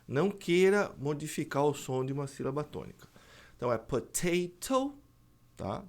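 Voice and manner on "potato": "potato" with both Ts crisply pronounced; British T. Shown as a demonstration. Teacher giving the British pronunciation, both Ts sounded. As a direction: say "potato" keeping each T a true T. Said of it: "No, no inglês britânico".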